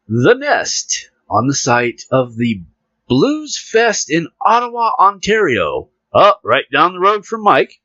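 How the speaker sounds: reading the news to the listeners, playful announcer; mock news read. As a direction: announce the story grandly, slowly. Reads news article aloud.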